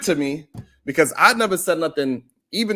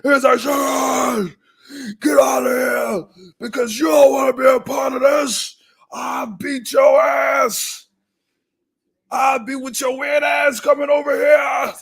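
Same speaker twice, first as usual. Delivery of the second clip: imitating a furious yell, higher, slow and drawn out; retold screaming; 8 semitones higher, slower than usual. Mimicking a hyper-aggressive man threatening him, a mock furious yell. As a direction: bark the threats like the angry dude did. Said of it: "He came up there screaming".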